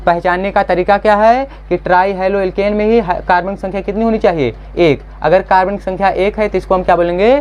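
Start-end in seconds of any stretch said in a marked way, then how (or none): none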